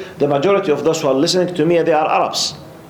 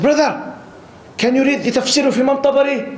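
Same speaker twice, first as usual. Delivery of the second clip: much higher voice, insistent quizzing; challenging questioner; 10 semitones higher than usual. Retold or imitated. Man in the mosque tests the newcomer, challenging. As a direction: imitate an earnest brother testing someone, pitched right up. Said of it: "He was asking me in this attitude".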